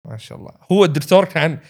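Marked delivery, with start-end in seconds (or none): 0.1-0.5 quiet sarcasm. Retold or imitated. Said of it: "الدكتور ... قال".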